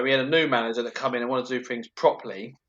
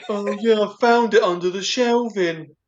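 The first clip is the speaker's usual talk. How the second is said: dopey coworker voice; 6 semitones higher, 4 dB louder, slower than usual. Retold or imitated. Voicing dim coworker Steve.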